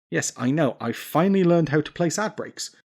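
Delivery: ordinary talk, nothing marked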